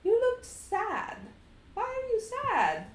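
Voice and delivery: put-on a small boy gently asking; little Strahd. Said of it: "little Strahd looks and says".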